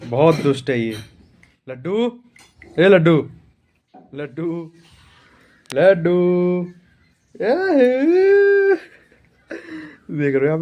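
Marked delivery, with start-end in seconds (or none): 1.7-6.2 calling out to the puppy Laddu, high and loud. Real, not acted. Owner calling his puppy Laddu to come. 7.4-8.8 long, high, drawn-out coaxing call. Real, not acted. Owner coaxing his puppy with a drawn-out high call.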